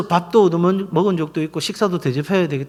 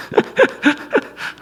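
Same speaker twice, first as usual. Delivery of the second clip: breathy high-pitched chuckle; laughter. Real, not acted. Pastor amused by his wife's suspicions, chuckling. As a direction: laugh in a short run of pulses.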